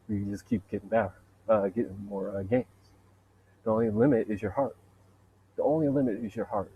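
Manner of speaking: normal talking voice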